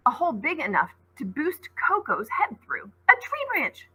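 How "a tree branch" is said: high, thrilled cry of discovery; excited cry. A narrator's reading. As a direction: call it out with a leap of joy. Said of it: "cried Coco".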